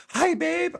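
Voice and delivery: sweet wife greeting. Retold or imitated. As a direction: chirp it like a cheery wife. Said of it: "And she's like".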